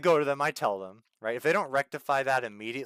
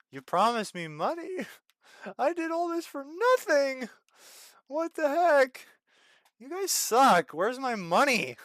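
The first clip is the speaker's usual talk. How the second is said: much higher and louder, whining with a laugh; outraged whine; 12 semitones higher, 4 dB louder, slower than usual. Real, not acted. Streamer griping at the game for stolen pay, high whine. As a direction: squeal in comic outrage.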